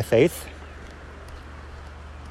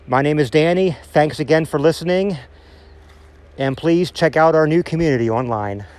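usual speaking voice

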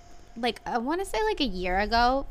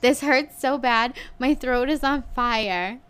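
louder, higher, whiny retold protest; hammed-up pained whine; pained complaining; 4 semitones higher, 5 dB louder than usual. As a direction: wail it like your throat burns. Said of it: "And I was like".

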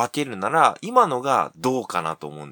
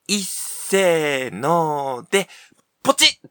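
excited countdown; 6 semitones higher, 4 dB louder, much slower than usual. Acted. Family blowing up their house.

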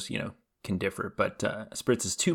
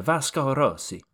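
hammed-up Italian, pitched higher; terrible Italian accent; 4 semitones higher than usual. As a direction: say it in a bad mock Italian accent. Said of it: "terrible Italian accent".

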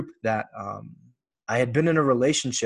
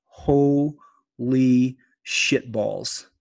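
slow awed realisation; much slower than usual. Retold or imitated. Realisation after man's feedback.

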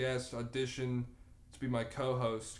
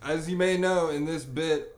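royal announcer; 4 semitones higher, 6 dB louder than usual. Acted. King host announcing bit.

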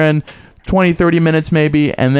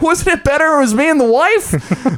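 much higher, put-on cheesy comedian voice; hack comic impression; 13 semitones higher than usual. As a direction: keep mocking the old-fashioned stand-up. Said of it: "So initially I was like".

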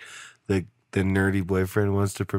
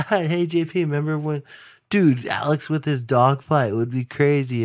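higher-pitched eager fan voice, lightly louder; mock eager fan; 7 semitones higher, 3 dB louder than usual. A fan stopping the host, mock eager voice. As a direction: play a pumped-up stranger greeting you. Imitating a random excited listener.

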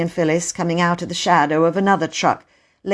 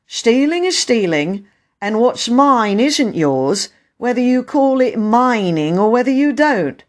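raised, emphatic voice, slowing in places; stern but kindly station master; 7 semitones higher, 5 dB louder than usual. Acted. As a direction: let a child off with a warning. Station master lets the children off.

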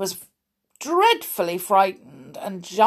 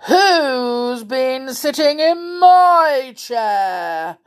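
big, slow, loud bear roar; angry growling bear; 6 semitones higher, 7 dB louder, much slower than usual. A narrator's reading. Father Bear finding his chair sat in, angry growl. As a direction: growl it out long and indignant. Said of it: "he growled angrily".

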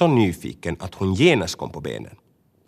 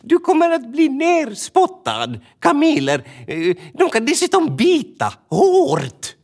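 much higher, louder, slowed warning; urgent raised calling; calling after; 4 dB louder, slower than usual. Acted. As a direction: bird shouting a warning after her. Tova calling after Bubba.

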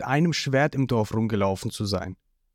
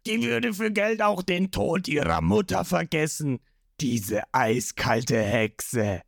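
a hint of a sneering fish-man boss; mock him slowly; villain's sneer; 6 semitones higher, slower than usual. A narrator's reading. Along mocking Zorro about Nami, lightly sneering.

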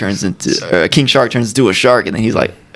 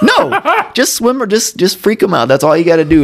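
mock exasperation, pitched up at the start; exasperated retort; 8 semitones higher than usual. Retelling the plan to the shark, exasperated. Exasperated correction in retold scene.

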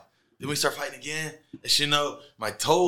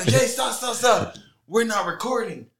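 higher, louder mock interruption; urgent halting call; 8 semitones higher, 5 dB louder than usual. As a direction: imitate someone calling a halt. Acts crew halting his entrance.

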